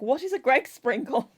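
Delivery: mock-offended demand to know; puzzled affront over a silly name; offended Greg. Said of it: "Greg is so offended".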